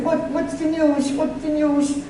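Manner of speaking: high, excited repeated question; retold eager question. Young girl asking the widow for her news, mildly excited and high. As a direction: imitate eager impatience, pitch jumping up.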